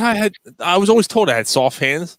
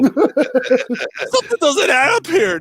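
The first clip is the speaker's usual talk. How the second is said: slow, high, drawn-out mock suspicion; mock detective; 11 semitones higher, 3 dB louder, much slower than usual. Friend in a podcast bit acting puzzled, slow mock suspicion. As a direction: stretch it out like a cartoon detective.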